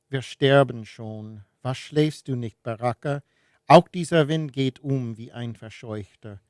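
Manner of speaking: measured, deliberate German poetry reading; solemnly paced literary recitation; poem recitation. Acted. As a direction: recite the verse line by line, pausing between phrases. Reads a poem aloud in German.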